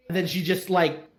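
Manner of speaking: ordinary talk, nothing marked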